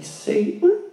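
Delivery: high prim church lady gasp; prim scandalized church lady. Retold or imitated. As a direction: clutch your pearls and squeal it. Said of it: "Sound like the church lady".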